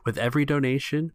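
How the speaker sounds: ordinary talk, nothing marked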